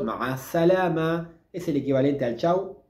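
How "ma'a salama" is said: ma'a salama joined together, slowly; all together, slow. Shown as a demonstration. Teacher joining ma'a salama into one phrase, slow. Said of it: "Todo junto".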